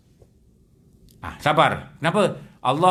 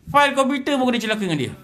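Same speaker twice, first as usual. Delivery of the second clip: cursing complainer; 8 semitones higher, faster than usual. Acted. Man cursing his broken file.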